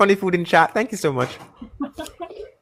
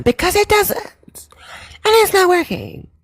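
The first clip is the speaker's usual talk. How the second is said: much higher, whispery mock falsetto with no bass; mock feminine voice; 10 semitones higher than usual. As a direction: take all the bass out and sneer in falsetto. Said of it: "I talk with a very extreme whisper".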